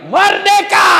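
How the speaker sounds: very high, loud triumphant yell; gleeful shout. Pretend. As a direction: scream it like freedom at last. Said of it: "dia tutup sambil teriak". (suami berteriak girang di kamar)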